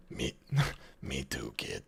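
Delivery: deep gravelly action-hero line; tough movie voice. Shown as a demonstration. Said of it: "could be a good soundboard clip".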